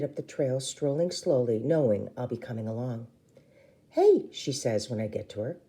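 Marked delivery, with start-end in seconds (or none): 3.9-4.3 bright hey. A narrator's reading. Rue greets sister brightly.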